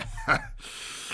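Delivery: short gleeful villain chuckle; a mischievous little cackle. Acted. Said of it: "grinchiously humming".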